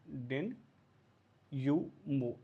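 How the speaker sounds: slow split into डेन, यू, मो; syllable by syllable. Shown as a demonstration. Teacher breaking the word apart for students, slowly. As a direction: spell out डेन... यू मो with pauses. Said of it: "ऐसे समझो".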